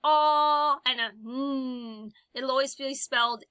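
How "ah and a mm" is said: ah and mm each drawn out slowly; teacher modelling the two sounds; isolated sounds. Shown as a demonstration. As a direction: hold each sound long. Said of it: "if you hear a".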